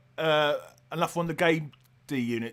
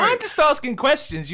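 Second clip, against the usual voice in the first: higher and louder, playing the wronged innocent; pitched up and louder, hammed-up innocence; answering the spoiler accusation with mock innocence; mock innocent protest; 10 semitones higher, 8 dB louder than usual. Mock defence after being accused.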